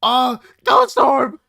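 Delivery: very loud, high, stretched yell; excited shout. Real, not acted. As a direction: scream it with delight. Excited at ghost orbs on screen.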